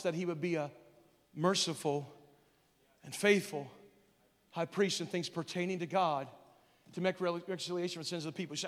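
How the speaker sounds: ordinary talk, nothing marked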